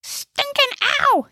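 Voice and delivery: very high, drawn-out comic yelp; slow high-pitched cry of pain. A narrator's reading. Gnesi pummelled by old lady's purse, high yelp. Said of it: "yelped Gnesi".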